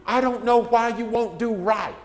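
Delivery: high, loud, scolding parent voice; exasperated parent. Retold or imitated. Parent scolding a little five-year-old in a loud, exasperated voice. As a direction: scold the child like a fed-up parent. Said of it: "you'll have parents say".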